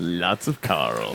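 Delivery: deep, slow sinister reply; low creepy voice. Acted. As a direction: drop low and drag it out. Creepy answer about the bucket.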